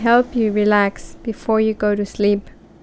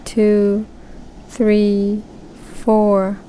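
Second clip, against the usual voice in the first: unhurried counting with pauses; slow breath counting; much slower than usual. Shown as a demonstration. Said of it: "We will start breathing to a count".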